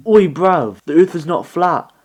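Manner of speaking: playful rough London geezer voice; rough London slang. Retold or imitated. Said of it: "maybe a scientist is like".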